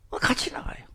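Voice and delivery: unvoiced whisper. A sermon aside about leaving together, whispered. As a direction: breathe it as a secret.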